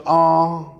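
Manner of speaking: slow, drawn-out condescending teacher; teacher's condescending voice. Retold or imitated. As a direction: talk down to the new kid, slowly. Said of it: "she looked at me and she goes".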